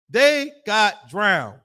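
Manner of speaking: They got drowned dragged out very slow, each word weighed; solemn preacher drawing out the verdict; slow emphatic repeat. Shown as a demonstration. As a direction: let it sink in, very slowly.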